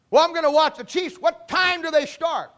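a hammed-up husband keen on the game; mock husband. Husband voiced asking about Chiefs game.

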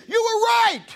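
very high, loud shout of "right"; triumphant lady. Retold or imitated. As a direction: crow the punchline like a thrilled churchgoer. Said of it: "She says".

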